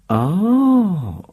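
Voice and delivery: "Oh" lifted high on a rising tune, ashamed; shameful rising oh. Acted. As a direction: let "Oh" climb upward, embarrassed. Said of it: "with a shameful, rising inflection".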